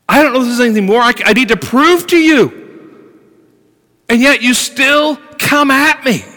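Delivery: exasperated outcry. Acted. Voicing Job's exasperated cry.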